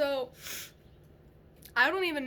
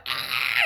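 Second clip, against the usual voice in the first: a low, loud hammed-up demon shriek; mock demon shriek; 13 semitones lower, 6 dB louder than usual. Demons fleeing the light, a mock low shriek. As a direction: play a demon scared off by the light. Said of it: "the demons go".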